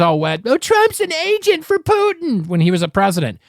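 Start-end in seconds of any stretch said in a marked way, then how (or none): 0.5-2.5 much higher, slow, whiny mock of critics; mock whine imitating the critics. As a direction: parody the complainers in a high slow whine. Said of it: "bitching and pissing and moaning".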